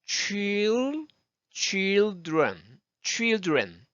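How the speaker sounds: put-on children in careful syllables, chil held before dren; deliberate teacher's children; pronunciation model. Shown as a demonstration. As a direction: stretch chil, then add dren for children. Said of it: "그렇게 발음하시면 돼요".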